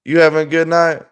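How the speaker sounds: bright eager shout-out; upbeat greeting. Real, not acted. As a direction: greet the viewer with a lift. Greeting a livestream viewer.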